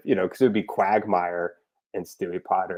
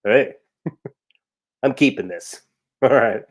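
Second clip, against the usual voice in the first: lightly voiced sleazy Quagmire, laughing through it; a hint of Quagmire grabbing the cloak; 2 semitones higher, 4 dB louder than usual. Quagmire pulls off the cloak, lightly voiced and chuckling. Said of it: "It's like".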